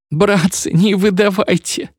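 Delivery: put-on hiding boy pleading in tears; tearful plea. A narrator's reading. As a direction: beg them with tears in the voice. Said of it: "плакал Фисухин".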